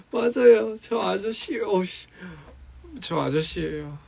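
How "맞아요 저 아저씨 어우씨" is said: laughing. Real, not acted. Laughing at being called ajusshi.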